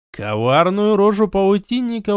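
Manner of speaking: usual speaking voice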